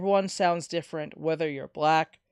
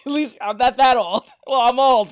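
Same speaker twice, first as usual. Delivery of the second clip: laughing, higher and louder; 6 semitones higher, 9 dB louder than usual. Real, not acted. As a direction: laugh through the words. Laughs at own age.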